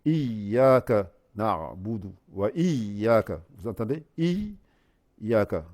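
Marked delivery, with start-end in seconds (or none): 0.0-3.4 Iyaka with the ya doubled, a held i-y then ya, slow. Shown as a demonstration. Teacher demonstrating to students a doubled ya, slowly. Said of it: "un premier ya avec soukoun et un ya avec fatha". 4.2-5.6 Iyaka said as i-ya, the ya clearly doubled. Shown as a demonstration. Reciting teacher showing the class both ya, deliberately. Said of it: "Je fais deux ya".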